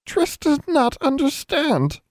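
very high, slow elf whimper; a small hurt creature, barely coping; whimpering house elf. A narrator's reading. Exhausted elf whimpering to Alexandra, very high and slow. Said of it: "then whimpered".

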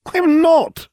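loud, high-pitched wail of denial. A narrator's reading. Brother denies being a werewolf, wailing. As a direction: wail it in indignant protest. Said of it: "Ron wailed".

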